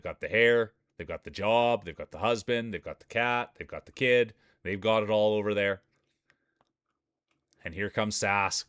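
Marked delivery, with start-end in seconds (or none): none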